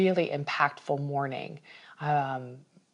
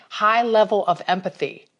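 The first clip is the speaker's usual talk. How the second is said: usual speaking voice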